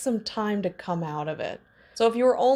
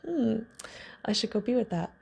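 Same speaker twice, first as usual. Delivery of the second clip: ordinary delivery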